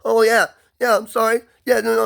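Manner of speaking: high, rushed, scared apologizing to cops; panicked pressured speech. Shown as a demonstration. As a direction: babble in panic like the cops just showed up. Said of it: "like I'm talking now".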